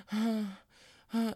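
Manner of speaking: short high gasped grunts; mock panting moans. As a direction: imitate spent little post-set moans. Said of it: "and she goes".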